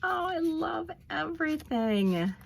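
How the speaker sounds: love for the whole gift spilling out; gushing delight. Real, not acted. Telling viewers she loves the whole package, high gush. Final gush over the gifts.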